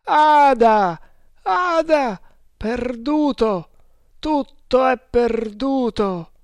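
high, anguished drawn-out wail; heart-rending cry. A narrator's reading. Man crying for his lost Ada, heart-rending cry. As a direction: cry out in agony, stretching the words. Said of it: "emise un urlo straziante".

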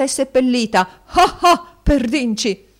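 high, loud theatrical laugh; boisterous, triumphant exclamation; crowing. A narrator's reading. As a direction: gloat with a big acted ha-ha. Husband crows with a mock laugh.

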